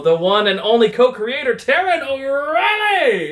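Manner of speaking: drawn-out, high showman intro; announcer hype. Acted. Host introducing his co-host like a ring announcer. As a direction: belt the name like a ring announcer.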